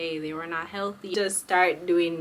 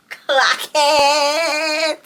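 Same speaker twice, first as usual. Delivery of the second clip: sassy brag; 6 semitones higher, 9 dB louder than usual. Real, not acted. Showing off toes.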